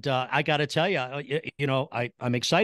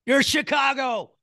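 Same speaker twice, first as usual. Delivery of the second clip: high, loud, drawn-out delight; host thrilled by the Chicago accent; 11 semitones higher, 5 dB louder, slower than usual. Real, not acted. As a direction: cheer him on, thrilled.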